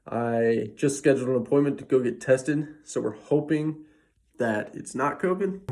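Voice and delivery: quiet, breathless and wheezing; short of breath, wheezy. Real, not acted. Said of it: "you should be able to hear my breathing here".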